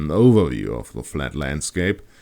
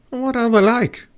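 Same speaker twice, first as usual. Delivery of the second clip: put-on high curious girl; 4 dB louder than usual. Kat asks.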